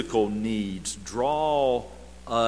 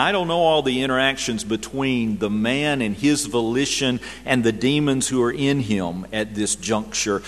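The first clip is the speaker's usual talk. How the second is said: normal talking voice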